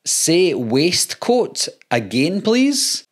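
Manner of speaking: slow, then a high rising please; pleading. Retold or imitated. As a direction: drag it out, then beg. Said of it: "Michael Wilbert said".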